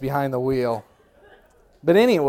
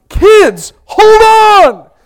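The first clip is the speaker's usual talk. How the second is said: high, loud shrill-free panicked cry; panicked mother; 16 dB louder, much slower than usual. Retold or imitated. Mother to kids in back seat, panicked loud cry. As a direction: warn the kids the car may crash.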